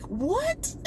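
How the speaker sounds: a very high, cracking cry of disbelief; shocked what. Retold or imitated. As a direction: squeal out the disbelief. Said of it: "And I was like".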